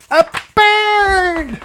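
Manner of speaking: high squeaky bird voice. Acted. To the listening child, a high squeaky bird voice. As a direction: squeak the word like a little bird. PJ announces new animal.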